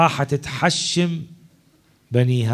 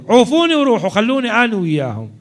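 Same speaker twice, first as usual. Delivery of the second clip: high, loud pleading cry; impassioned cry; 8 semitones higher, 4 dB louder than usual. Acted. The Imam begs his companions to leave him, loud pleading cry. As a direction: cry out, begging them to go.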